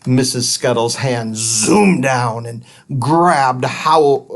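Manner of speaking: usual speaking voice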